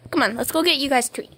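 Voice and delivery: ordinary delivery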